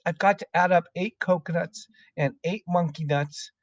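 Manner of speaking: high-pitched anxious schoolboy; flustered pupil begging for time; flustered plea. A narrator's reading. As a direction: plead in a small, high boy's voice. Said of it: "Poor Wilfred was properly flustered".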